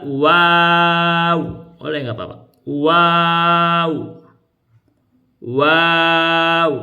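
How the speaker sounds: Wow stretched long and recited; elongated letter wow. Shown as a demonstration. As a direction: hold the vowel of Wow. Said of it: "wow itu bisa dipanjang juga".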